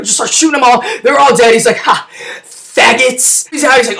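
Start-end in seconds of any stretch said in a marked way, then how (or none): 1.8-3.5 very slow, stretched gloating; smug drawl. Retold or imitated. Chelios over dead guards, slow smug gloat. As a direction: savor the gloat slowly. After killing guards, drawn out.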